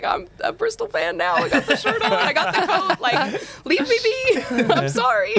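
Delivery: very high, laughing self-defence; mock pleading protest. Player acting out her reply to the teasing, laughing mock protest. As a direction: plead playfully to be left alone, pitch way up. Retold protest at being teased.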